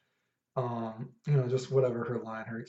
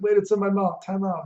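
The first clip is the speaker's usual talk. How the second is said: startled quote; 9 semitones higher, 10 dB louder than usual. Retold or imitated. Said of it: "She's like".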